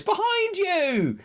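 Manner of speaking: high, slow drawn-out call; pantomime warning. Retold or imitated. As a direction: warn him like a panto crowd. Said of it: "And the hologram goes".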